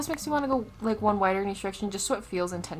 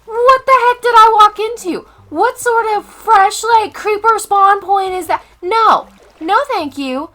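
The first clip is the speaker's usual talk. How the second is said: high, loud, disbelieving rant; exasperated shouting; 11 semitones higher, 13 dB louder than usual. Real, not acted. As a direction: yell it, refusing this cave. Reacting to creeper spawn point.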